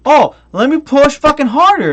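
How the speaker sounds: high, loud mock kid voice pushing on the TV; mocking dumb kid. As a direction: ham up a clueless kid shoving harder. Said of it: "he's like".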